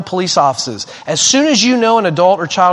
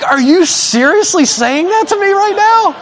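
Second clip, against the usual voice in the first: high, incredulous retort; high-pitched comic outrage; mock indignation; 12 semitones higher, 4 dB louder than usual. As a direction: act out hammed-up disbelief, pitched way up. Retold indignant reaction to stranger.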